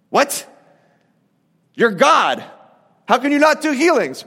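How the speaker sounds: high-pitched, disbelieving questioning; acted astonishment, pitch jumping up; mock incredulity. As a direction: challenge it as if you can't believe it. Acts out disbelieving reaction to scripture.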